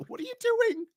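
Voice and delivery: high squeaky baffled question; mock baffled Tucker. To the podcast co-host, mimicking Tucker, high baffled question.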